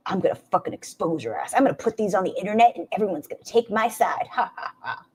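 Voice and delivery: mock villain voice with a fake evil laugh; hammed-up plotting voice, quickening into a mock cackle; Zachary Michael. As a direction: play a scheming villain gloating, ending in breathy ha-ha-ha. Mockingly voices Zachary plotting to expose her.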